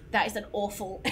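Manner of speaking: ordinary talk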